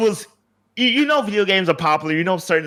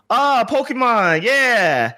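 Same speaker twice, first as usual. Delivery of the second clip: drawn-out, higher-pitched delighted recognition; excited foreign fans; 6 semitones higher, much slower than usual. Retold or imitated. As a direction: imitate a thrilled non-English speaker spotting Pokemon. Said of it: "people who didn't speak English go".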